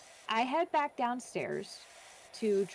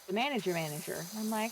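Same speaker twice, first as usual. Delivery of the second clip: usual speaking voice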